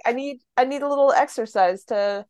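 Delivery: usual speaking voice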